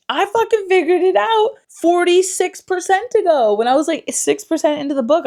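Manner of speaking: high, loud and worked up, fast at the end; loud exasperated rant. Real, not acted. Complaining to viewers she guessed early, loud and high. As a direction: vent it like you can't believe it. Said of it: "I'm like".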